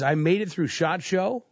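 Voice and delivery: normal talking voice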